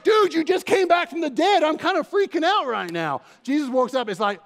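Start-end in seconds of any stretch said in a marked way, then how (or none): 0.0-3.2 high, loud, panicked mock disciples; a hammed-up panic, much higher and louder; freaked-out disciples. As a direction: play the disciples totally freaking out.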